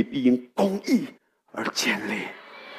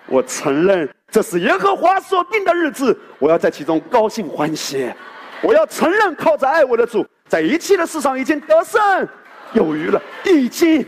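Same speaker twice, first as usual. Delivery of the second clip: preaching at full force; 7 semitones higher than usual. Acted. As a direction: proclaim victory with full conviction. Triumphant declaration of faith.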